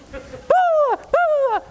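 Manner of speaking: very high yammering bah bah; mom's ranting babble. Retold or imitated. Frantic mother ranting at her son, very high babble.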